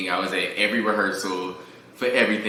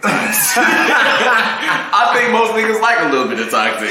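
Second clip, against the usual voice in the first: laughing; 12 semitones higher, 7 dB louder, slower than usual. Real, not acted. Laughing admission, host teasing.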